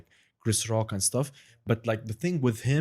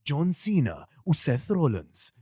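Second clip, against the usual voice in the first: higher, stretched wrestler names; wrestling announcer; 5 semitones higher, slower than usual. Shown as a demonstration. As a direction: call them out like a ring announcer. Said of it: "it just becomes so excited".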